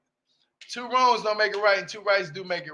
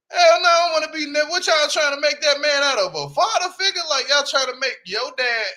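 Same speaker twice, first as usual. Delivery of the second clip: higher, louder, fast outraged burst; 6 semitones higher, 4 dB louder than usual. Real, not acted. Reacting to the show, outraged disbelief. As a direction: blurt it out in disbelief.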